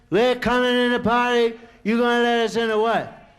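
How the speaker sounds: loud, rough demand; gang demanding entry. Retold or imitated. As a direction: bang on the door with it.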